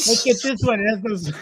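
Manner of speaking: high mock-grating shopper; obnoxious woman voice. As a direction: mimic her grating voice, high. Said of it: "world's most obnoxious voice".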